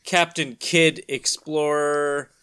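usual speaking voice

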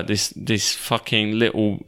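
ordinary talk

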